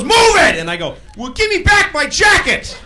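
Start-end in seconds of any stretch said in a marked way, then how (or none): 0.0-0.6 high, loud angry order; yelling driver. Retold or imitated. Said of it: "he kept yelling at me". 1.2-2.8 high, loud accusing shout; argumentative yell. Acted. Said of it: "I'm gonna argue with this guy".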